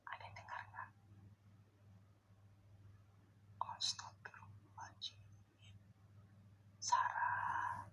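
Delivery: hushed, breathless whisper to the dark; fearful whisper. Real, not acted. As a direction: whisper so nothing hears you. Whispering to unseen crying ghost. (berbisik ketakutan)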